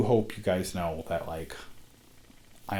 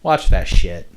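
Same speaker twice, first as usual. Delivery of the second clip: loud; 14 dB louder, slower than usual. Real, not acted. Loud closing plug.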